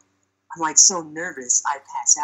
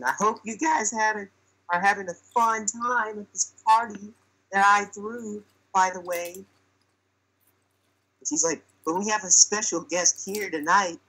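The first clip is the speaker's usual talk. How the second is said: higher-pitched imitation of a girl hosting; party host; 6 semitones higher than usual. Girl addressing her party guests, higher mock host voice. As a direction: play the girl making her speech, pitched up.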